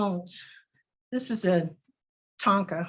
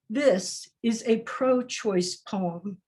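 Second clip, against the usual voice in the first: slow, drawn-out repeat of This is a pro-choice poem; slow refrain; much slower than usual. Shown as a demonstration. Poet repeating her refrain to the audience, slowly. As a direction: stretch the repeat, letting each word sit.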